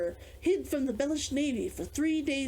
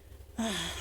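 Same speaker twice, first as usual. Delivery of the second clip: long relieved exhale; sigh of relief; 4 semitones lower, 5 dB quieter than usual. Acted. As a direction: let out a soft sigh of relief. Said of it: "She breathed a sigh of relief".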